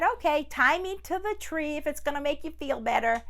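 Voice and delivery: a tiger humouring him; tiger agreeing. Acted. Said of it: "He said".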